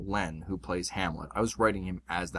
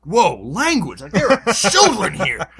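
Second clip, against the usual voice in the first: loud, high, shocked scolding; scandalized shout; 10 semitones higher, 11 dB louder, slower than usual. Retold or imitated. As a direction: call out the swear in mock outrage. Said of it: "someone else will call them out".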